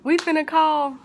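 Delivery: high, angry demand; snatch the phone, furious; yelling. Retold or imitated. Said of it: "They were yelling at me".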